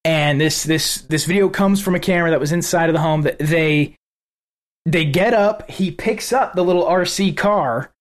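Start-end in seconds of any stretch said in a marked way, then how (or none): none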